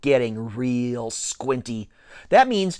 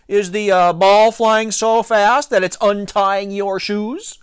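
drawn-out, raised-voice taunt; taunting shout; 5 semitones higher, 4 dB louder, slower than usual. A narrator's reading. Pitcher mocking a rival tying his shoe, shouting. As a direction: call it out slowly, higher, to needle him. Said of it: "he shouted".

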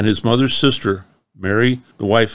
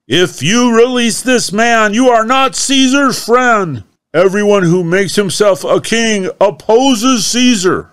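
much higher, loud threatening shout; crying out; 12 semitones higher, 7 dB louder than usual. Acted. Crowd threatening Pilate about Caesar, high loud shout. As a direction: yell it as a warning to the governor.

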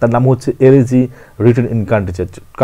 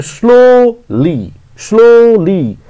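Slowly raised and drawn out as slo, lee; syllable by syllable; 8 semitones higher, 4 dB louder, much slower than usual. Shown as a demonstration. Teacher showing two syllables, slowly split apart. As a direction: stretch the first beat of slowly, then pause. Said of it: "এখানে দুটো syllable রয়েছে".

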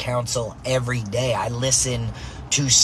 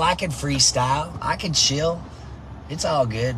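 usual speaking voice

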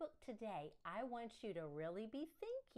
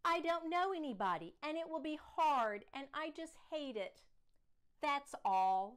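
loud, higher whining protest; upset child; 6 semitones higher, 6 dB louder than usual. Acted. Daughter protesting new school to father, loud and higher. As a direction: wail that it's all hopeless.

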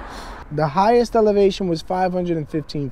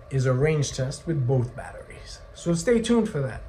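hushed teaser whisper; 4 semitones lower, 8 dB quieter than usual. Real, not acted. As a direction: whisper it like a secret. Teasing next video.

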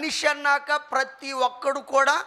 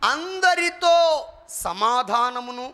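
slow lead reading; much slower than usual. Shown as a demonstration. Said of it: "preacher leads verse slowly".

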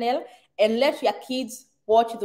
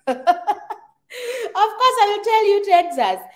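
high, louder laugh through the words; laughing, emphatic; 10 semitones higher, 5 dB louder, much slower than usual. Real, not acted. Laughing as she insists to Michelle on Texas. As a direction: burst out laughing as you insist. Repeats answer to viewer about states.

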